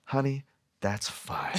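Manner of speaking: slow, soft, laughing "honey" of a kind waitress; sweet waitress. Retold or imitated. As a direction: soothe them like a motherly server. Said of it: "They're like".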